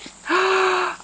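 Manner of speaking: a sharp high gasp of delight. Real, not acted. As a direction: gasp at the pretty fabric. Delighted gasp opening a package.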